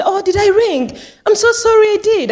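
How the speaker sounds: put-on high, apologetic old-lady quote. Old resident apologising for ringing.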